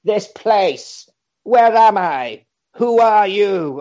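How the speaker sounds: drawn-out demonic questioning; different, possessed voice. Retold or imitated. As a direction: imitate someone turning demon. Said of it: "says in a different voice".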